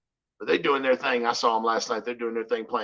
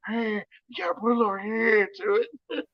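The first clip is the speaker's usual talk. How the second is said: hammed-up, silly old politician, pitched higher; goofy Biden voice; 9 semitones higher than usual. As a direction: put a little goofy hair on it. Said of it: "as goofy as I can make it".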